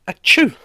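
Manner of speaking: loud high sneeze; loud fake sneeze. Allergic to dogs.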